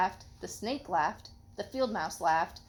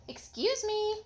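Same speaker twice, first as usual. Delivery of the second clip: high little sparrow voice; 12 semitones higher, slower than usual. Acted. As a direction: chirp it like a tiny bird. Sparrow voiced in read story.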